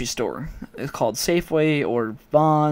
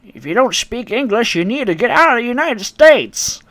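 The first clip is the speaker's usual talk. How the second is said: higher, rising into a louder scold; mock outraged granny, pitched up; angry old lady; 9 semitones higher, 6 dB louder than usual. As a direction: imitate a glaring old woman telling you off. Racist customer voiced in story.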